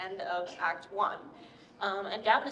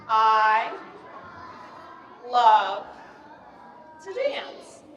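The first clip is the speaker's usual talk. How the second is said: word-by-word demo; 6 dB louder, much slower than usual. Shown as a demonstration. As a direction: lead a group to copy each word. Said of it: "can you guys copy me".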